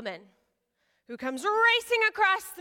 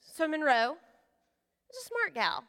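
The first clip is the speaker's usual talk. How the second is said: normal talking voice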